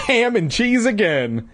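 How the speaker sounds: high, whiny complaint; groaning. A narrator's reading. Boy examining his lunch sandwich, groaning. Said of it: "he groaned".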